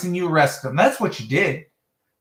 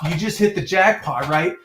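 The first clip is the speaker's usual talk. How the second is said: normal talking voice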